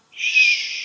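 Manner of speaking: a sharp unvoiced hush; shush. Real, not acted. Vlogger hushing himself mid-rant, a sharp unvoiced shh. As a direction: hush yourself with a quick shh. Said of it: "hushing himself".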